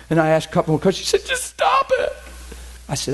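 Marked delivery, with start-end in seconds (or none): 1.3-2.1 imitated girl. Girl's plea imitated.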